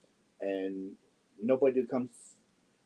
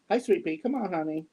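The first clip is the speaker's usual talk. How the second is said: coaxing a cat; 6 semitones higher than usual. Real, not acted. Calling the cat over.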